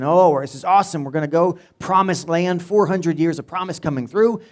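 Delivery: hammed-up eager Israelites; excited crowd. People cheering on the promise.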